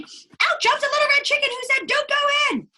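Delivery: high, eager chicken voice; imitating Chicken. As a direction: squeak it like the chick. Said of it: "just keeps saying".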